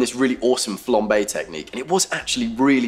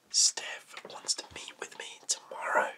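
hushed, secretive whisper; 12 dB quieter than usual. Acted. Man tells his friend about his ex, whispering. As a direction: whisper it so the others won't hear. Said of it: "why am I whispering?".

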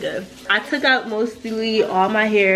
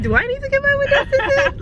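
excited. Real, not acted. Excited about the tinted windows.

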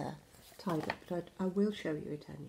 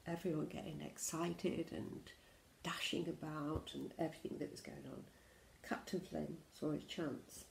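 plain reading voice

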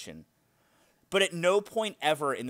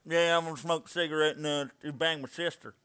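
heavy southern drawl. Shown as a demonstration. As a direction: overdo a southern country boy. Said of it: "not like talking like this".

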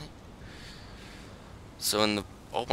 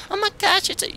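much higher and louder, like an amazed viewer; mock amazement; 6 dB louder than usual. Gamer mocks viewers who think the game is easy, in high mock amazement. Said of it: "You're probably like".

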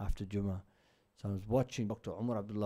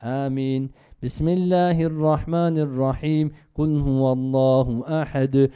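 chanted Arabic prayer recitation, loud and sustained; Quran recitation; 7 dB louder than usual. Shown as a demonstration. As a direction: recite it as in prayer, carrying each verse.

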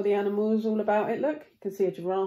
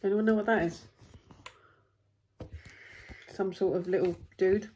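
ordinary delivery

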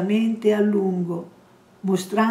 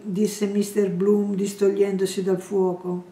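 usual speaking voice

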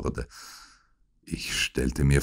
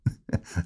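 low-volume, breathy villain chuckle; soft chuckle; 8 dB quieter than usual. A narrator's reading. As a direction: chuckle quietly at the sick man. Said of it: "Der andere lachte". (leises Lachen)

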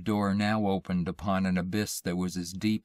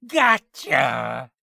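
high, loud triumphant shriek; shrieked pounce; 5 semitones higher, 7 dB louder than usual. A narrator's reading. Thing seizing man by the neck, shrieking. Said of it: "A mouth gaped and shrieked".

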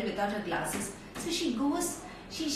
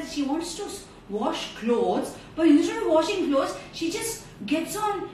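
ordinary delivery, nothing marked

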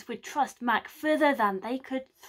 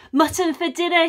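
higher and louder, proud boast; crowing; 6 semitones higher, 7 dB louder than usual. Acted. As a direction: crow with triumph. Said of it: "he crowed".

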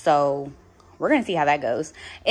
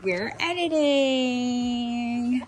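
higher, long drawn-out singsong; gleeful announcement; 7 semitones higher, much slower than usual. Real, not acted. Vlogger celebrating that she can edit videos, gleeful. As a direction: stretch it out in happy triumph. Celebrates finally getting phone fixed.

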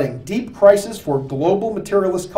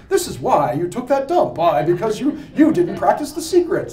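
mock American guru; 2 semitones higher than usual. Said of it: "an American comes in and says".

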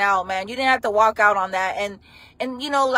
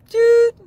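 loud high keypad tone; keypad beep; 11 semitones higher, 8 dB louder than usual. Pretend. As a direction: beep like a pressed button. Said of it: "when I do the phone calls".

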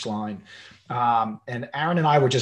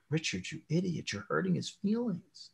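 softer, hushed rebuke to himself; self-scolding inner voice; 4 semitones higher, 8 dB quieter than usual. Retold or imitated. Father hushedly rebuking himself after upsetting his son, softer. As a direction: scold yourself under your breath, softer. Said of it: "I'm like".

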